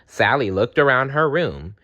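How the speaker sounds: plain reading voice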